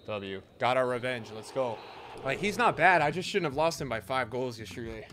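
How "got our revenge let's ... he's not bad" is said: normal talking voice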